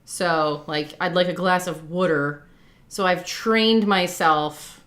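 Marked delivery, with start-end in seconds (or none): 1.0-2.4 "wooder" with an oo as in wood; casual Jersey example, saying "wooder" for water; New Jersey pronunciation. Shown as a demonstration. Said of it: "people in New Jersey say".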